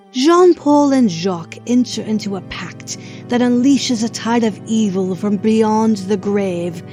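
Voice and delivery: ordinary talk, nothing marked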